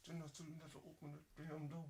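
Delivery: lightly voiced cussing aunt; a hint of a scolding aunt. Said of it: "So when she cuss".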